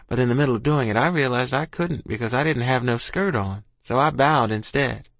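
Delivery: ordinary talk, nothing marked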